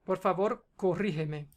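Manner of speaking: ordinary delivery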